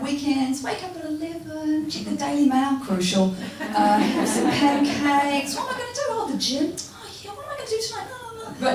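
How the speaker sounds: high, breezy and lazy; carefree old self. Retold or imitated. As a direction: drift through a lazy weekend. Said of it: "I was like".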